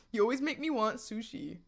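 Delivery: ordinary talk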